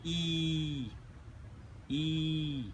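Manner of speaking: long, steady "E", held and repeated; drawn-out teaching "E"; vowel demonstration. Shown as a demonstration. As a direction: hold each "E" evenly, then stop. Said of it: "Now I will pronounce".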